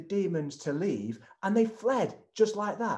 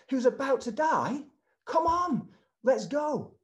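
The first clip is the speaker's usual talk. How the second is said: impatient disciples; 8 semitones higher than usual. Acted. Disciples urging Jesus not to stop, impatient and pleading. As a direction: hurry him along, pleading that time is running out.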